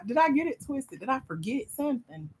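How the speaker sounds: usual speaking voice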